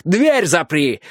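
gruff bark; barked order. A narrator's reading. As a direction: bark the command. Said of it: "рявкнул он".

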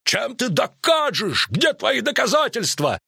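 high, indignant shouted demand; outraged high-pitched demand; Висигерд yelling. A narrator's reading. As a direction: yell a challenge across the hall. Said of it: "орал Висигерд".

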